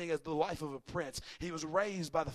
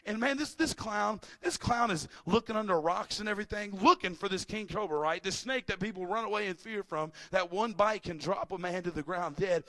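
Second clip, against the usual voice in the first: ordinary talk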